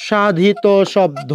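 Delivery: সাধিত শব্দ said slowly, each word weighted; slow emphasis. Shown as a demonstration. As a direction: stress সাধিত শব্দ so they remember it. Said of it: "কি সাধিত শব্দ মাথায় রাখবেন?". (মনে রাখার জন্য সাধিত শব্দ ধীরে জোর দিয়ে)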